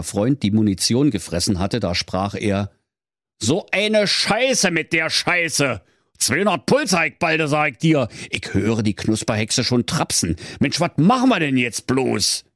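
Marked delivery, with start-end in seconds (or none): 3.4-12.4 wolf in a panic over lost ammo; higher flustered Berlin wolf; panicky wolf. Acted.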